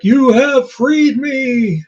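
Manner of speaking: grand, drawn-out genie proclamation. Acted. Genie granting three guys a wish, grand proclamation. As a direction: proclaim it like a genie freed from a bottle. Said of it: "And he's like".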